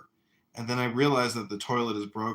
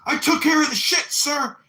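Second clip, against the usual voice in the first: high, loud acted military reply; shouted recruit report; 9 dB louder than usual. Recruit reports to his drill sergeant, shouting high. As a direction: yell it like an eager recruit.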